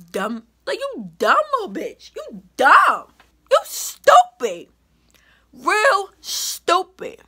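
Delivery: loud, high, stretched-out scornful yelling; angry insults. Retold or imitated. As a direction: spit the insults out, slowing on stupid. Insulting the friend who betrayed her.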